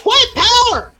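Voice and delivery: very high, loud hillbilly shout; hillbilly accent. Retold or imitated. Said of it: "And the guy goes".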